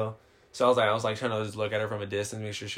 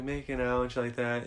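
normal talking voice

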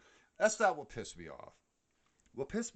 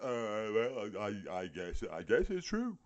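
dim coworker; 2 semitones lower than usual. Retold or imitated. Mocking coworkers who believe the boss, dim and stammering. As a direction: play a confused yokel agreeing. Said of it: "they're like".

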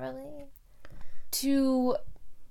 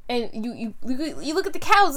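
ordinary talk

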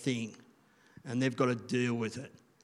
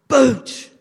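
loud, high punch on boot; mock kick; 10 semitones higher, 14 dB louder than usual. Teacher mimics the boy booting his bag, loud and high. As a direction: bang the word out like a kick.

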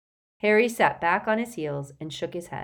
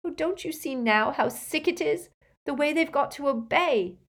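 higher-pitched, upset pleading; distressed plea; 9 semitones higher than usual. Acted. Girl pleads that elves must obey, distressed. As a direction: plead on the elf's behalf.

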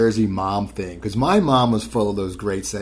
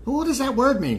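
high voiced quote; 12 semitones higher than usual. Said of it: "I'll say to someone".

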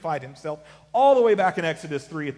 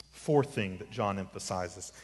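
normal talking voice

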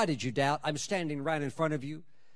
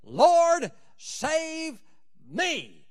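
urgent cry; 11 semitones higher, 4 dB louder, much slower than usual. Pretend. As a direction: Lord cried out high and loud, then save me dropping away. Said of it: "Get to the point".

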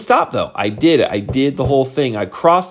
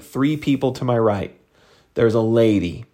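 plain reading voice

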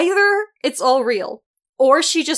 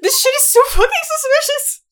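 incredulous; 13 semitones higher, 3 dB louder than usual. Real, not acted. Mock outrage at the story.

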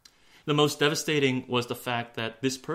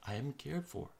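soft, fading self-affirmation; hushed inward reassurance; saying to oneself; 7 dB quieter than usual. Acted. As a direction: say it quietly, as if to yourself. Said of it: "say it to yourself".

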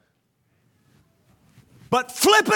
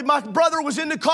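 ordinary talk, nothing marked

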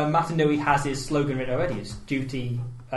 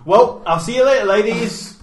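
loud, high hammy catchphrase; cheesy catchphrase; 7 semitones higher, 8 dB louder than usual. Pretend. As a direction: sell it like a sitcom hero. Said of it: "Can we say catchphrases now?".

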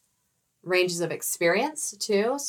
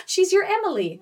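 excited; 11 semitones higher, 5 dB louder than usual. Retold or imitated.

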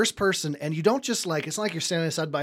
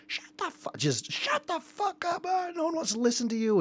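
screaming mom. Retold or imitated. Mom bursts in on her gaming son, high mock scream. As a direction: shriek it high like a furious mother. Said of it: "his mom ... screaming".

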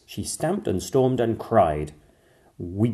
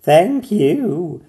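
high, much louder mermaid voice; mermaid thanks; 11 semitones higher, 11 dB louder than usual. A narrator's reading. Mermaid thanking the doctors warmly. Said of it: "said the mermaid".

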